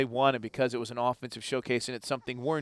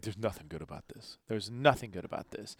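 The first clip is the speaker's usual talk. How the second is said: low, subdued imitation of a dejected analyst; downbeat quote; 4 semitones lower, 8 dB quieter than usual. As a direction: quote him softly, as if all is lost. Voices gloomy analyst after game.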